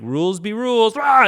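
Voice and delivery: high, loud mock roar; God gleefully threatening rule breakers, hammed up; mock wrathful God. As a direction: ham up a rule-mad deity. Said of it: "He's just like".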